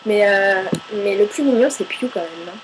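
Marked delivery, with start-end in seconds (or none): none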